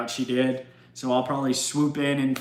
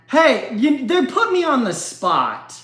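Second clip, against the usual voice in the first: high, loud defensive outburst; pitched-up "don't blame me" cry; mock protest; 10 semitones higher, 5 dB louder than usual. Real, not acted. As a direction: protest loudly in playful self-defence. Mock protest after accent bit.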